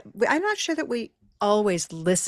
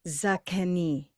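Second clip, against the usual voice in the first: "Zucchini." slower with the stress on KI; slower and clearer; 3 dB quieter, slower than usual. Shown as a demonstration. Asked to repeat, she says it slowly and clearly. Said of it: "Say it one more time".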